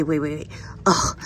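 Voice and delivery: normal talking voice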